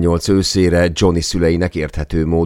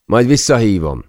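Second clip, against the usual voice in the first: louder, higher reply across the room; calling back; 8 semitones higher, 5 dB louder than usual. A narrator's reading. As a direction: call your answer back to a colleague. Answers colleague's shout across room. (visszakiáltva, emelt hangon)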